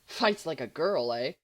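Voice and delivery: ordinary delivery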